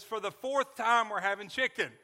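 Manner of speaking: wife spins round angrily at her deaf husband, loud annoyed snap; angry wife. Retold or imitated. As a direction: bite his head off like a fed-up wife. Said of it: "She spins around angrily and says".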